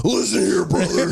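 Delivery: pro-wrestling promo voice; WWE wrestler. Acted. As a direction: growl it like a wrestler cutting a promo. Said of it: "W W E".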